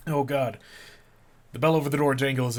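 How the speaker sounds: reading through sudden hiccups; talking with the hiccups. Real, not acted. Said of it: "I have the hiccups now".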